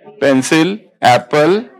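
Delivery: one word per letter, each set apart; spelling by picture words. Shown as a demonstration. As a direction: spell it out like a phonics chart. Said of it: "ऐसे पढ़ना है".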